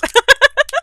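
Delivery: high, loud giggle; short burst of high laughter; excited giggle. Real, not acted. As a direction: giggle with anticipation. Giggles, cannot wait.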